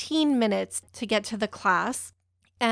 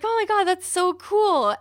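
high, excited reaction; retold gush, pitched well up; 9 semitones higher than usual. Replying to photographer about the class, excited gushing.

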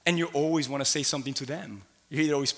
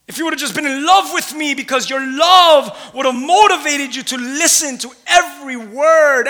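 booming, much higher pleading voice of God; 10 semitones higher, 10 dB louder than usual. Acted. Preacher voicing God pleading with his people, loud and high. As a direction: cry out as God longing to be heard.

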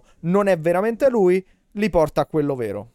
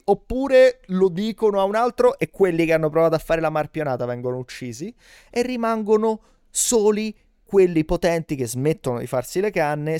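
ordinary talk, nothing marked

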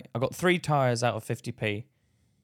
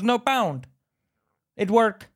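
high, loud mock contradiction; dismissive and pitched up; insistent shop lady; 6 semitones higher, 3 dB louder, slower than usual. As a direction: imitate a shopkeeper refusing to listen.

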